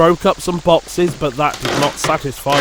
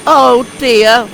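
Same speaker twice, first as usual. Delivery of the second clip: sadly; 8 semitones higher, 6 dB louder than usual. Acted. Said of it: "thought Percy sadly".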